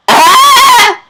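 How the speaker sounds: short shrill scream at full volume; test scream. Real, not acted. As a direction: let out one piercing high scream. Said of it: "I'm going to scream right now".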